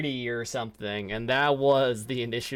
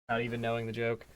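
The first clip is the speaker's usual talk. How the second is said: ordinary talk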